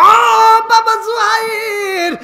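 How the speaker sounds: high, slow crying call; mother's weeping cry. Acted. Mother crying to her son, high weeping call. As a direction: wail the name in tears. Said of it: "মা কান্দার কয়".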